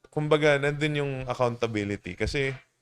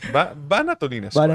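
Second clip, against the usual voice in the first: loud, higher playful urging; mock gung-ho lifter; 5 semitones higher, 9 dB louder than usual. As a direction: throw it out like an overeager lifter. Acts the greedy lifter going again. (pabirong sigaw ng sabik na lifter)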